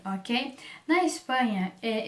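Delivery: ordinary talk, nothing marked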